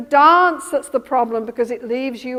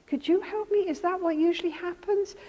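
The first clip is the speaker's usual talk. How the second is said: higher, softer plea with hurt underneath; tearful plea; 5 semitones higher, 4 dB quieter than usual. Retold or imitated. Therapist voicing the wife's hurt plea, softly tearful. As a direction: let the sadness under the anger show as you ask. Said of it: "I noticed that you started to cry".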